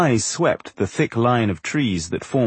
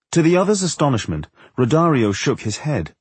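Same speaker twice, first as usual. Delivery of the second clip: plain reading voice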